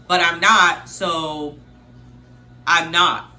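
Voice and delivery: loud, emphatic denial with a pause; imitating a defensive boyfriend, loudly; defensive insistence. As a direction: dig in hard, hitting NOT. Acts his insistent side of argument.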